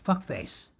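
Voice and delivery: high yelled insult at the dog. Retold or imitated. Caller yelling at her dog, high yelled insult. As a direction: yell it out sharp and high. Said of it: "she yelled out".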